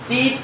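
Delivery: টিপ with an unaspirated hard ট; টিপ opening on Bangla ট, not ঠ; mispronounced T. Shown as a demonstration. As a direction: say টিপ with no puff on the T. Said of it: "আমি ভুল উচ্চারণ করবো".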